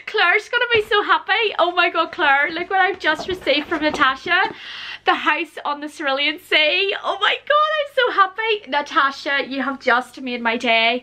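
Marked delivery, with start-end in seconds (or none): 0.1-8.7 high, gushing with joy; excited. Real, not acted. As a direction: bubble over with happiness. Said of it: "I was very excited for this one".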